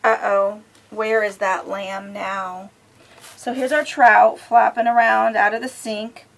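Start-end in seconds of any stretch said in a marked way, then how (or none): 0.0-2.7 sing-song picture-book reading; reading to children. A narrator's reading. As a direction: lilt it for a little listener.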